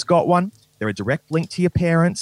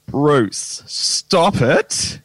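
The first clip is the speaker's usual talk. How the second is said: mock bashful; 5 dB louder, much slower than usual. Mock flattered reaction to praise.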